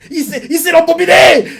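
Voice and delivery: high, loud indignant outburst; outraged shout. Real, not acted. Reader reacts to the smooth talker, shouting in outrage. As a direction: yell in fan outrage.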